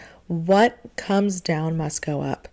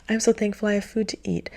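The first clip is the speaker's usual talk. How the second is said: ordinary talk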